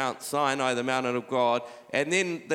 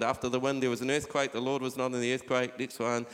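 normal talking voice

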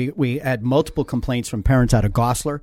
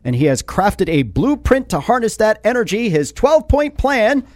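upbeat radio ad read, higher and louder; 10 semitones higher, 4 dB louder than usual. Acted. Radio host reading the mayor's sponsor spot, upbeat ad voice.

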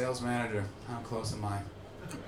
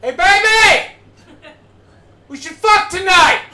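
much higher, much louder street holler; catcall yell; 13 semitones higher, 11 dB louder, slower than usual. Retold or imitated. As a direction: bellow it across the street at her. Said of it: "he literally just yelled across the street".

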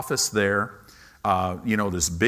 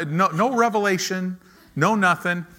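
ordinary talk

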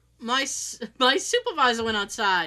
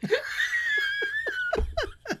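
squealing laugh up high; 4 dB quieter than usual. Real, not acted. Big laugh after retold scream.

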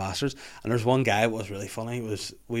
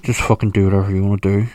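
much louder, fed-up blurt; exasperated outburst; 12 dB louder than usual. Retold or imitated. Husband snapping loudly at his wife over the telly. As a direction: throw your hands up and let it out loud. Exasperated reply to wife's choice.

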